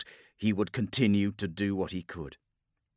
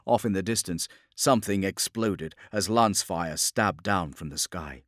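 usual speaking voice

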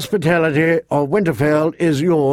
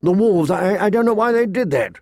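high, stammering bewilderment; shaken; 7 semitones higher than usual. A narrator's reading. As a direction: stumble into a baffled apology. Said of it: "Even Robb looked shaken".

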